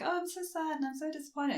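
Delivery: high, soft put-on sorrow; plaintive, hammed-up regret; mock sad disappointment. She feigns regret after offering hand, mock sad. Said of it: "The way she says it".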